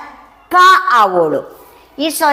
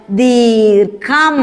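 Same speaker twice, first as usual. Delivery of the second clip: ദീർഘം stretched very slowly; slow drawn-out; much slower than usual. Shown as a demonstration. Teacher stretching the word for children to repeat. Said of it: "പറഞ്ഞേ".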